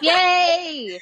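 loud happy cheer; excited cheer. Real, not acted. Celebrating finishing recording.

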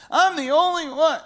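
high whiny self-pity; whiny prayer. Retold or imitated. Imitating a listener's self-pitying prayer to God, high and whiny. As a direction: whimper it like a sulky kid.

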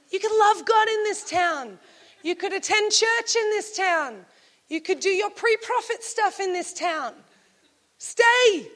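high, insistent urging; coaxing plea. Acted. Elijah urging Elisha to settle in this town, coaxing.

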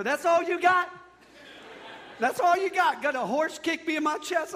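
high, loud incredulous protest; mock outrage. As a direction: throw it back loudly in comic disbelief. Acted outrage at horse-kick remedy.

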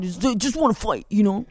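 lightly voiced eager young fighter; the impatient student who only wants to win; a hint of a hotheaded kid with a laugh; Daniel's way of talking. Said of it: "talk like that".

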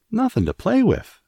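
high, loud, incredulous echo; astonished exclamation. A narrator's reading. Martha echoing Mary's complaint, exclaimed in astonishment. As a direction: exclaim it in high-pitched disbelief. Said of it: "exclaimed Martha".

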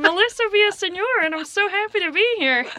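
very high put-on comedian voice; Melissa Villaseñor. Guest greets audience as her, stiff high impression. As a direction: greet the crowd in a high character voice. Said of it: "You know, she's like".

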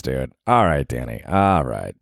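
normal talking voice

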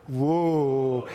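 low, drawn-out whoa; long crowd-style whoa; fireworks whoa. Shown as a demonstration. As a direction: demonstrate awe at fireworks. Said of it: "like a fireworks".

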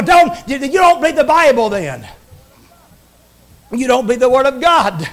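ordinary talk, nothing marked

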